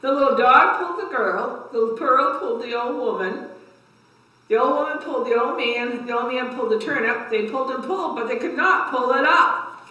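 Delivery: lively, rising read-aloud for little ones; story time voice for a class; reading to children. A narrator's reading. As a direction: build the effort with each pull. Reads cumulative tale to kids.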